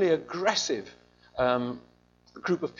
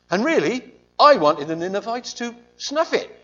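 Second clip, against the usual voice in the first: Jonah's grudge; 5 semitones higher, 7 dB louder than usual. Retold or imitated. Jonah confessing his wish.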